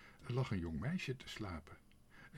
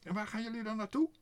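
very high, fast, loud question; high-pitched crow character, hurried; agitated crow; 14 semitones higher, 7 dB louder, faster than usual. A narrator's reading. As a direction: fire off the question in a squawky bird voice. Crow questions the departing family.

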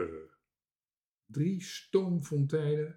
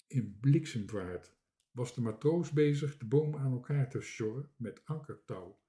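ordinary delivery, nothing marked

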